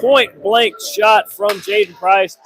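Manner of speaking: loud, drawn-out play-by-play shout; excited call. Real, not acted. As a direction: call the big chance with a burst. Big scoring chance called.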